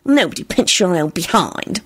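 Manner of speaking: mildly irritated retort; irritable. A narrator's reading. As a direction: snub her with mild irritation. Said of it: "Solange retorted irritably".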